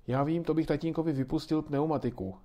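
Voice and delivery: put-on a boy eagerly calling out his idea; eager exclamation. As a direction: blurt it out like an excited kid. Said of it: "zvolal Ondra".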